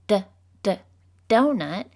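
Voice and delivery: "d, d, donut" with two short separate d sounds before the word; sounding out D. Shown as a demonstration. Teacher sounding out a counter-example, separated. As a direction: tap "d" twice, then say "donut". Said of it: "if I were to say the word donut".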